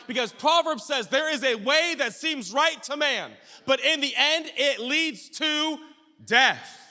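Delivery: high, shouted preacher's cadence; full-force preaching. Real, not acted. Preacher telling the congregation God corrects our ways, full-force preaching. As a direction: shout each phrase home to the congregation.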